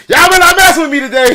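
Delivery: loud, high mock threat through laughter; playful shout. To his family watching online, a laughing mock shouted threat. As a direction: bellow the warning half-joking, grinning. Repeats warning, much louder, laughing.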